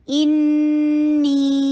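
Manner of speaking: انی with the ya held four counts; slow recited انی; stretched madd. Shown as a demonstration. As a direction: draw out the ya of انی. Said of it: "یا کو stretch کریں گے".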